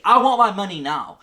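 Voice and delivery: acted indignant shout; mock angry demand. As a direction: demand it like an impatient customer. Bank-run crowd demanding money.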